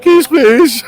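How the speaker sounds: high, squeaky request; mock polite high voice. Drunk man at door asking.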